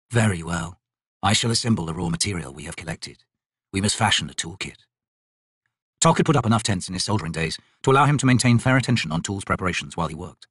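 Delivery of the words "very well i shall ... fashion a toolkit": low, soft, measured voice; stolid warrior. Acted. Undead warrior planning arrow-making with the soldier, stolid. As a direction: speak low and impassively.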